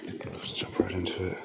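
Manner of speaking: whisper. Real, not acted. Whispering at a haunted site.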